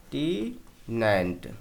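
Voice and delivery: टीनेंट drawn out slowly, teacher modelling; slow model word. Shown as a demonstration. Teacher spells out टीनेंट slowly for the class. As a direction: say टीनेंट slowly as "tee-nant". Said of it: "टीनेंट इसको हम पढ़ेंगे".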